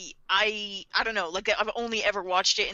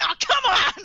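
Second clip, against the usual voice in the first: higher, louder frustrated outburst; mock angry task force; 11 semitones higher, 6 dB louder than usual. Task force members exasperated at a second Kira, mock fury. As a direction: throw up your hands in mock outrage. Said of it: "They're, like, so mad".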